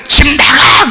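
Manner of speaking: high, drawn-out imitated yell; mock parent's shout. Old-time parent ordering child out, mock shouting. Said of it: "옛날에는".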